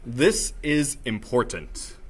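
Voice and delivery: slow, weighty, serious delivery; important low voice. Shown as a demonstration. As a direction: stretch each word out with gravity. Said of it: "in low voice".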